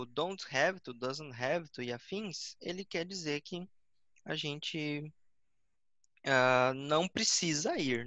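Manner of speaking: ordinary delivery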